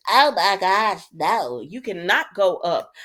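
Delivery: loud, exclaimed protest; mock alarm. Acted reaction to the episode.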